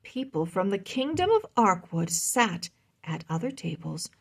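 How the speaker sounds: ordinary delivery, nothing marked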